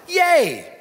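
high little yay of joy; a sudden high cheer; mock cheer. Preacher cheering mid-verse for the congregation.

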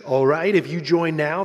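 hint of a punchy announcer pitch. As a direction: sell it lightly like the promo announcer. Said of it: "the voice said".

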